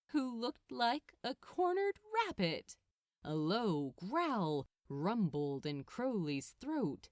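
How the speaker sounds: ordinary delivery, nothing marked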